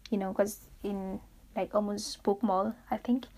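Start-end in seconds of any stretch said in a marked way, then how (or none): none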